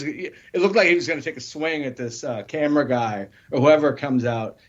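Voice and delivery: ordinary talk, nothing marked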